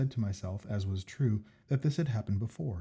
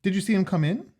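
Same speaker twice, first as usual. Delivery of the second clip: higher, eager Jack; Jack's voice; 9 semitones higher, 8 dB louder than usual. Acted. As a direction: voice the keen friend Jack, pitched up. Jack's voice in story.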